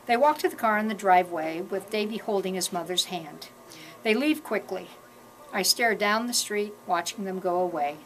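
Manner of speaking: usual speaking voice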